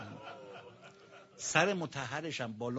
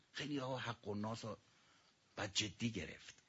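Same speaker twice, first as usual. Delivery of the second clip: usual speaking voice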